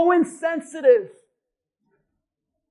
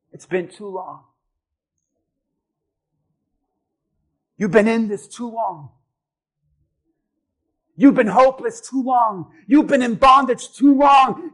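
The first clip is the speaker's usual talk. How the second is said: Jesus speaking, building; 8 dB louder than usual. Acted. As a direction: begin hushed, then build each line louder. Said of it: "and knows and says".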